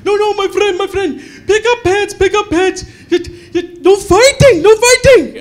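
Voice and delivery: high, loud waiter voice with a laugh; Indian waiters. Retold or imitated. Indian waiters rush over to stop the fight, high loud waiter voice. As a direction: play frantic waiters breaking it up. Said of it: "two Indian waiters ... they're like".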